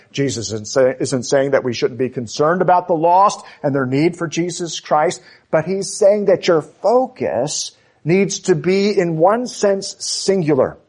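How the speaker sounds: ordinary talk, nothing marked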